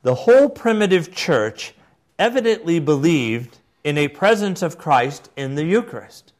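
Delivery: put-on declaimed quotation in an English accent. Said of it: "read it in an English accent".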